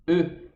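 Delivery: "E" through oval, rounded lips; rounded French E. Shown as a demonstration. Teacher showing lip rounding, French rounded E. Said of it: "fechar os lábios num formato".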